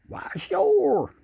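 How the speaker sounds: high, loud put-on fish voice; goofy talking fish. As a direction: answer like a cheerful fish. Fish answer catchphrase.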